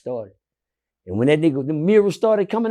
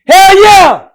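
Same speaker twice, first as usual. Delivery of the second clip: excited shout; 14 semitones higher, 17 dB louder than usual. Real, not acted. Answering his friend with an excited shout. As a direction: shout it out, high and loud.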